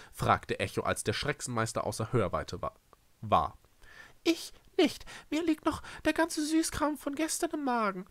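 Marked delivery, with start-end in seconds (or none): 4.2-8.1 very high little character voice; high-pitched grumble; Echo's creature voice. Acted. Echo declines more food, complaining.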